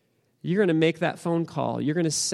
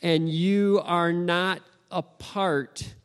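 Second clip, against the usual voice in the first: slow, deliberate sermon delivery; emphatic preaching; 3 semitones higher, slower than usual. Real, not acted. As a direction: let each phrase land slowly as an assurance.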